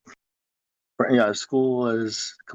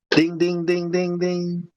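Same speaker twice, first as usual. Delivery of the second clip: higher, evenly repeated dings; imitated school bell; 5 semitones higher, slower than usual. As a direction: chime like a bell on one pitch.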